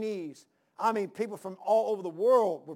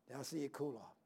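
cooling off; 6 semitones lower, 16 dB quieter than usual. Shown as a demonstration. Preacher shows the congregation cooling off, voice dropping away. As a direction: voice sinks low and soft on cool off. Voice drops for cool off.

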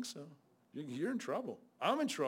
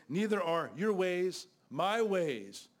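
each word laid down slowly and loudly; slow, emphatic scripture; 2 semitones higher, 4 dB louder, much slower than usual. Shown as a demonstration. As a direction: read God's words with slow weight. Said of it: "This is God speaking".

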